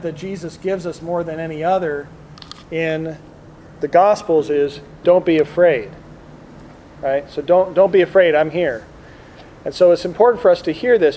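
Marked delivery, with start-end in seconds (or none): none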